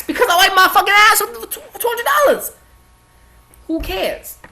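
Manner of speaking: loud, brash rant outburst; defiant shout. Acted. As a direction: blurt it out loud and proud. Defiant punchline to a mock question.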